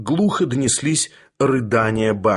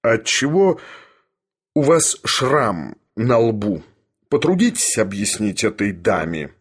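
insinuating. A narrator's reading. Professor questions Sharikov about his scar, insinuating. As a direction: draw the question out silkily. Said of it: "вкратчиво спросил Филипп Филиппыч".

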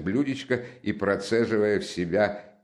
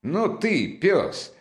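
high-pitched, playful scolding exclamation; sharp jump up in pitch; sudden cry; 12 semitones higher, 3 dB louder, much slower than usual. A narrator's reading. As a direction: cry out in mock outrage, about to laugh. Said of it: "вдруг крикнула Настасья и прыснула со смеха".

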